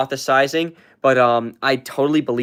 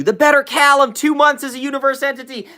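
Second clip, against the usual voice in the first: hyped; 11 semitones higher than usual. Real, not acted. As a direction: hype up the comment. Hyped reading of chat.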